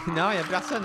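loud, tense and jumpy; nervous shout into empty room; scared horror game streamer. Real, not acted. Tense bank search in horror game.

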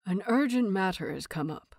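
plain reading voice

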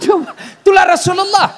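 high laughing burst; laughing shout. Real, not acted. Laughing at his own joke.

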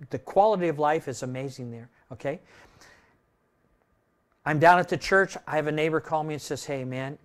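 ordinary delivery

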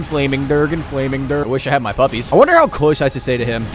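ordinary talk, nothing marked

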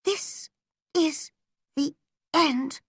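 high, halting schoolgirl misery; dramatic girlish despair, broken by pauses; despairing thought. A narrator's reading. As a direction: think it aloud word by word in despair. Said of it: "she was thinking".

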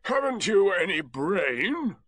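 put-upon donkey's disdain; scornful Eeyore. Acted. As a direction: draw out the insult. Eeyore scornfully insults Rabbit.

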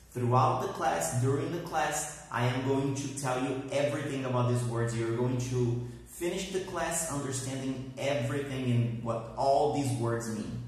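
usual speaking voice

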